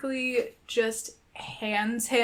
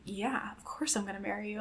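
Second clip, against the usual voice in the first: much softer, near whisper; hushed reassurance; 2 semitones lower, 16 dB quieter than usual. Retold or imitated. Partner accepting the marriage proposal, hushed and reassuring. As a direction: say it very softly, as a retold reassurance. Retold tender reassurance to fiancé.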